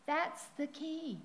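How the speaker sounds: usual speaking voice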